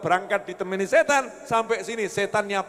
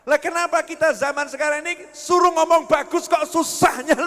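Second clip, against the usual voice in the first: ordinary delivery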